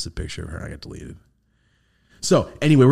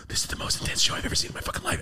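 hushed, quick, voiceless thought; awed inner whisper; 7 dB quieter, faster than usual. Retold or imitated. Tripping viewer thinking to himself during an intense show, awed whisper. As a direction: whisper it to yourself in amazement.

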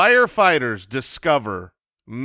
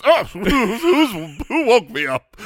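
groggy monster voice; 5 semitones higher than usual. Pretend. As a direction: growl it like something woken from ages of sleep. Plumber woken, acted quote.

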